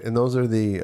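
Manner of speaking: ordinary delivery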